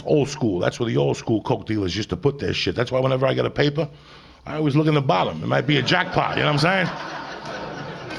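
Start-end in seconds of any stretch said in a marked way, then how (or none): 6.4-8.2 slow, laughing through the words; laughing aside. Real, not acted. Comic to audience, laughing at his own joke. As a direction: chuckle it out to the crowd.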